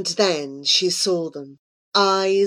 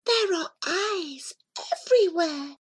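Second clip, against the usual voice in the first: whispering Miss Gory; 10 semitones higher, 5 dB quieter than usual. A narrator's reading. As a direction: whisper high and small, in wonder. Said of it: "Miss Gory whispered".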